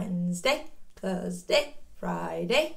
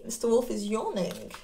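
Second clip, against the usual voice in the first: ordinary delivery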